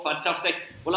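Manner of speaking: normal talking voice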